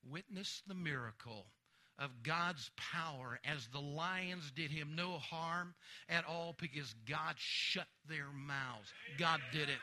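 usual speaking voice

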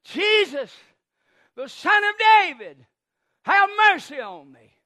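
pleading shout, falling away at the end; crying out. Acted. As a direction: call out over a crowd for mercy. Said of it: "I imagine Bartimaeus as he cries".

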